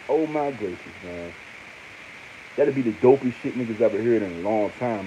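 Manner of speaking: ordinary talk, nothing marked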